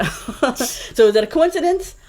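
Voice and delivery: laughing through it, pitch up; laughing question. Real, not acted. Laughing as she asks the host if it is a coincidence. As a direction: ask it with a laugh in the voice. Laughing while asking rhetorically.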